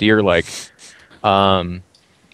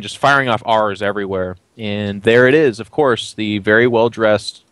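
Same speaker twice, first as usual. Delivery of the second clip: ordinary talk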